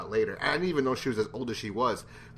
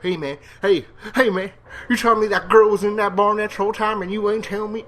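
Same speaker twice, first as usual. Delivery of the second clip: higher, louder Southern-tinged Shane; terrible Shane; 9 semitones higher, 7 dB louder than usual. Retold or imitated. Reviewer doing a bad Shane confronting Herschel. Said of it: "I know that's a terrible Shane".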